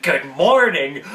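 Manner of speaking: slow, loud, drawn-out teacher greeting. Acted. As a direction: greet a student with stretched-out emphasis. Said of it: "your teacher stops you and goes".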